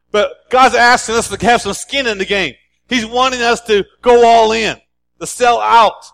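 fiery sermon climax; preaching at full force. Acted. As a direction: preach it hard, rising.

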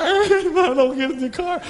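mock child whine; whiny child. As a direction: pout like a left-out kid. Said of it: "everybody's getting a new car".

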